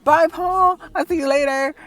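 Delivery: high, loud, sing-out farewell; nice-nasty goodbye. Retold or imitated. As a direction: chirp a fake-cheery bye to the boss. Cheery nice-nasty farewell to boss.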